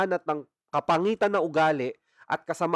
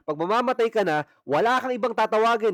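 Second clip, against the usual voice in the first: forceful preaching; 6 semitones higher, 3 dB louder than usual. Real, not acted. Preaching climax.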